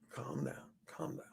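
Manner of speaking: breathy whisper hushing the crowd; whispered calm down. Retold or imitated. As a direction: hush them in a whisper. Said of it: "going like this to the crowd".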